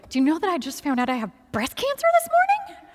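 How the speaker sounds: high, rising disbelief; incredulous blurting. Retold or imitated. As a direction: blurt it at a passing stranger, mock-incredulous. Imagined blurting to strangers.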